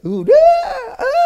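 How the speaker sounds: imitated loud infant crying; mock baby wail. Father showing how his baby cried, mock wail. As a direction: wail briefly like a newborn baby. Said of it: "Dia nangis".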